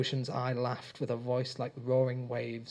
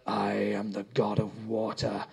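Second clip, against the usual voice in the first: slow rasping whispered threat; eerie creature whisper; 3 semitones lower than usual. A narrator's reading. The Ocean's Eye menacing Rob in a slow whisper. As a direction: breathe out the threat. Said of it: "the Ocean's Eye shouted".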